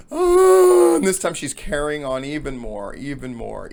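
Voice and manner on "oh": loud, high, drawn-out mock wail. Woman carrying on to get his attention, exaggerated wail. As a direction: wail one long hammed-up "oh" to get attention. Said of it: "she's there crying again".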